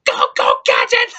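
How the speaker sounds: mock the villain Claw with a shrill squeak; mocking Claw squeal. Reviewer mocking how the movie ruined Claw, squeaky mock squeal. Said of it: "he's like".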